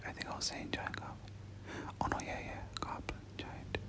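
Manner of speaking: ordinary talk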